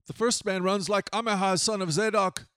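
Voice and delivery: restrained narrated call. A narrator's reading. Watchman calls down to king.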